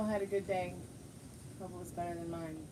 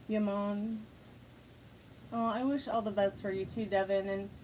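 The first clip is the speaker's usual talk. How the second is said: ordinary delivery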